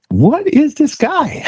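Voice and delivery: high, slow disbelief; lightly mocking surprise at the pastor; incredulous. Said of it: "And I'm like".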